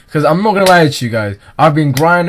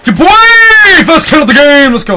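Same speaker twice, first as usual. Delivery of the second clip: high, loud triumphant shout; hyped celebration; 13 semitones higher, 9 dB louder than usual. Real, not acted. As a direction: cheer the first kill. First kill celebration.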